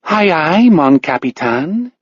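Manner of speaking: a lightly voiced stage Irish accent; mock Irish accent. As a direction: play the cheeky Irish lass. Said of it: "Her Irish accent is surprisingly good".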